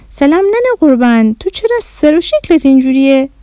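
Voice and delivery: high-pitched, surprised granny; puzzled grandmother. Acted. Grandmother puzzled by Bahram's looks, surprised granny voice. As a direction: greet fondly, then wonder aloud. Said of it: "مامان بزرگ با تعجب".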